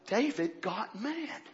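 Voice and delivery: normal talking voice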